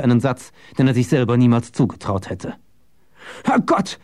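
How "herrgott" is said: high-pitched startled exclamation; shocked, high yelp of a word; startled cry. A narrator's reading. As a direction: cry out as if jumped on. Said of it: "schrie er".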